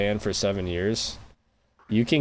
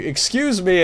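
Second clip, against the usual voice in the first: higher, louder "Excuse me" with a falling end; 9 semitones higher, 3 dB louder than usual. Pretend. Mocking how to get by in Japan, a loud polite call. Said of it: "really loud in every conversation".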